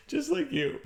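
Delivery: high laughing just like you. Real, not acted. Reassuring viewers, high laughing.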